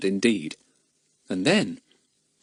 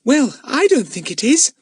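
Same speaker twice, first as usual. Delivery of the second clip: put-on high, loud child's voice; high-pitched contradiction; dissenting little girl; 5 dB louder than usual. A narrator's reading. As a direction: disagree boldly in a small child's voice. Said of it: "said Betts unexpectedly".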